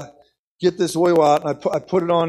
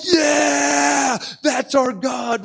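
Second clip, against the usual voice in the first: high, drawn-out shout of triumph; amped crowd cheer; 8 semitones higher, much slower than usual. Retold or imitated. Preacher voices the amped onlookers, excited shout. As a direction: cheer it slowly, imitating a fired-up crowd. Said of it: "the people would get more amped".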